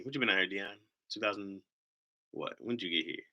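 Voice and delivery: ordinary delivery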